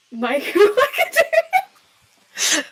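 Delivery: laughing. Real, not acted. Embarrassed answer, laughing.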